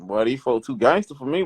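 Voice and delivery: usual speaking voice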